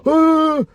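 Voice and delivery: sudden high, loud "Ah!" of a panicking animal; mock frantic cry, all energy at once; panicked yelp. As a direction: blurt out one burst of panic. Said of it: "It's not gonna go".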